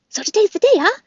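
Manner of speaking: high, bright squirrel voice, quick and eager; a chirpy little squirrel popping down from the trees; the squirrel Chit Chat greeting Bright Bill, high and chirpy; chattering squirrel. Acted.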